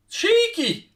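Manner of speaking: high, loud one-word exclamation of delight; delighted cry. Real, not acted. Commentator admires Messi's cheeky corner, delighted cry. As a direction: squeal it out in admiration. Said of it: "cheeky little corner there".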